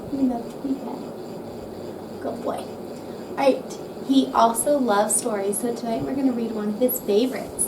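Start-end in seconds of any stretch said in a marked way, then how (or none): 0.1-2.2 soft unvoiced whisper to the owl; quiet. Real, not acted. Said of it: "we have to be quiet and calm". 2.2-3.8 soft whisper; quiet. Real, not acted. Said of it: "we have to be quiet and calm".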